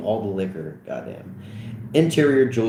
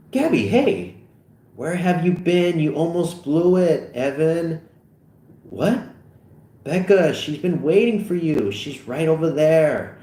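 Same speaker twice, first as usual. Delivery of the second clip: put-on higher, eager teen voices; excited teen chatter; 7 semitones higher than usual. Gabby teasing Evan about Becca, voiced higher and eager. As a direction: talk fast and eager about the girl.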